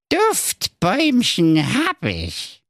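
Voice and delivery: slow, grumpy, high dwarf voice. Acted. Dwarf answers Marco grumpily, slow and high. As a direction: drag it out sulkily, like a surly dwarf. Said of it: "sagte der Zwerg Grützelbart mürrisch".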